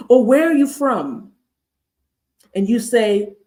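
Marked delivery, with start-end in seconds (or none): 0.3-1.3 higher, slow mock curiosity; acted intrusive questioning, pitched up; prying question. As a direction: imitate a nosy stranger, drawing it out. Acts out a prying microaggression.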